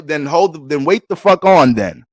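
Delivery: loud, annoyed snap back; angry retort. Acted. Fed-up partner tells the dealmakers to wait, angry loud retort. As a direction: bark it back, fed up with waiting. Acted argument over the deal.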